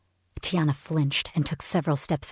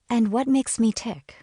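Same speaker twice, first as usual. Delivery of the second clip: much higher, teasing playful rise; saucy lilt; 8 semitones higher than usual. A narrator's reading. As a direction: toss the question back flirtatiously. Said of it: "a saucy lilt to my voice".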